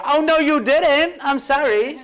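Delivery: high, loud, drawn-out mock scolding; finger-wagging sister. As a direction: imitate an indignant big sister wagging her finger. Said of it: "my sister's the first to be wagging the finger".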